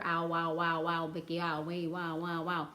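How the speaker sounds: "ow" and "wow" chanted with the gliding OW vowel; vowel demonstration. Shown as a demonstration. Teacher showing the vowel pattern, "wow" with a gliding OW. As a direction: repeat "wow, wow" in a low voice, sounding out OW. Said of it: "this vowel pattern right here".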